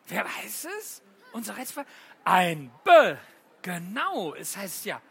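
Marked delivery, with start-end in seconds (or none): none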